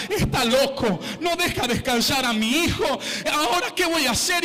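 a mother losing it at the funeral; desperate mother. Retold or imitated. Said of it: "diciendo desesperada". (madre desesperada, voz aguda y angustiada)

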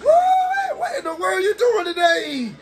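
squeaky high disbelief; stunned gamer yelp; shocked what. Real, not acted. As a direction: react like you can't believe the move. Shocked by opponent's move.

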